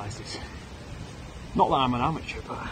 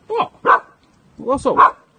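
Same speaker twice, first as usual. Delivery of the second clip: calling dog; 12 semitones higher, 12 dB louder, slower than usual. Real, not acted.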